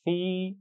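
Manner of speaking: सी held long without a hiss; long ee, no whistle. Shown as a demonstration. Teacher models सी for students: long, no whistle. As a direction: draw सी out cleanly. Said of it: "सा की आवाज़ में सीटी नहीं होनी चाहिए".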